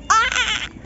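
mock falling yelp; mock scream. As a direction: replay the yelp as you go down. Reenacting the fall.

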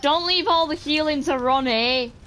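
pleading call to a teammate. Real, not acted. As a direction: call out over the game, begging. Calling teammate to help.

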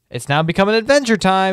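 loud, high hype call; proclaiming it like a show title; excited announcement. Acted. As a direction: shout the start of the adventure. Hyped announcement of adventure.

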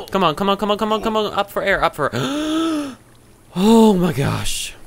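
high, frantic pleading into a gasp; scared. Real, not acted. Player nearly out of air underwater, scared and frantic. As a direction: beg urgently up high, then a long relieved cry. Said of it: "That scared the heck out of me".

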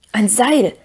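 a touch of excitement; excited, relieved. A narrator's reading. Sam seizes on the rope idea, mildly excited. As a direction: exclaim with mild relief. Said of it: "rief Sam vor Aufregung und Erleichterung".

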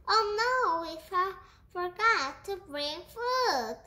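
high, alarmed realization; dismayed exclamation. A narrator's reading. Rat finding the food forgotten, dismayed cry. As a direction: blurt it out in high-pitched dismay.

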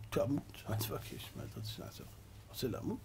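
very soft, voiceless mutter; hushed retort. Acted. Advisers answering stubborn Haman, hushed near-whisper.

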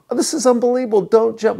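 loud, very high, hammed-up amazement; ecstatic disbelief. Rescuer amazed at their shared church, ecstatic disbelief. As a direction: exclaim it at the top of your range.

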